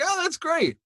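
very high, delighted squeal; squeaky happy Pharaoh; mock Pharaoh. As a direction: play Pharaoh as pleased and clueless. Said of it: "He's like".

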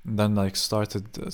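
ordinary talk

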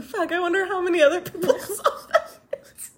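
high, laughing dismay. Retold or imitated. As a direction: laugh it out, embarrassed. Retelling her panic.

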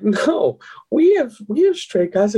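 high-pitched protest as a woman; insistent friend. Retold or imitated. Friend insisting to a doubtful man about flight attendants, high insistent imitation. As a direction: imitate her, pitched well up.

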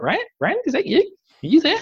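high, strained voice of a blinded friend; blinded Matt calling. Retold or imitated. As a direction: call out high and lost. Blinded Matt calls for Rand.

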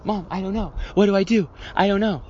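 put-on high-pitched helpless child appealing to mom; mock-panicked little boy; imitated panicky kid.

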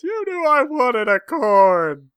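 high, loud, very slow sob; hammed-up tearful mother-in-law; mock wailing. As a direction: whine out the betrayal. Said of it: "tears streaming down the face".

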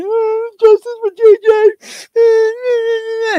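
high, wailing mock sob; fake crying. Mocking a woman in court, hammed-up fake crying. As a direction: imitate a put-on weep, squealed and loud. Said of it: "was fake crying".

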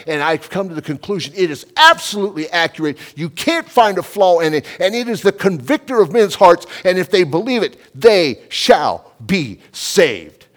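loud, high, driving preacher delivery; full-force preaching. Retold or imitated. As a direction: preach it hard, hammering the key words.

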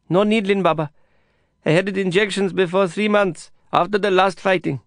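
a hint of Amir's eager voice; young patient Amir. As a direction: brush off the doctor politely.

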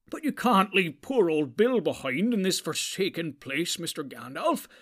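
upset hobbit begging for his pony; angry and distressed. A narrator's reading. Sam to Gandalf about the pony, angry and distressed. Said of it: "angry and distressed".

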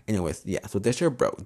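ordinary talk

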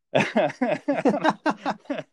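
loud, high-pitched rolling laugh; long real burst of laughter; hearty laugh. Real, not acted. Storyteller laughing over his digging punishment, hearty laugh.

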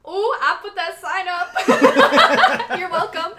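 loud laughing boast through laughter. Real, not acted. Cohost claiming the sign, laughing loudly. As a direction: crack up and brag between laughs.

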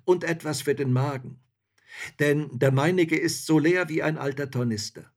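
hungry soldier pleading his case boldly; forthright soldier. A narrator's reading.

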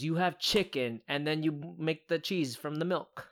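usual speaking voice

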